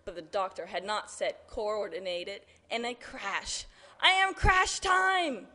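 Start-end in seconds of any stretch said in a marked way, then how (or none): 4.0-5.5 high, loud, slow put-on cry; yelling machine character. A narrator's reading. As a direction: yell it long and high. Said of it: "yelled the tardis".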